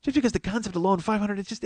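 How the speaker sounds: usual speaking voice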